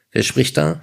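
high, irritated query; annoyed. A narrator's reading. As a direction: demand an answer with a touch of irritation. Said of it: "fragte Tom verärgert".

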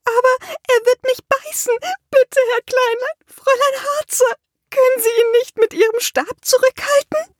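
shrill begging to the dwarf and witch; shriller. A narrator's reading. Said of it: "Ihre Stimme wurde schriller".

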